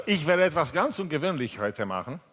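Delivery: usual speaking voice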